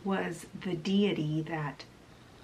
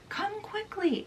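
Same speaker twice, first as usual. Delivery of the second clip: high, soft siren urging him on; 14 semitones higher, 5 dB quieter than usual. Acted. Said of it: "she's like".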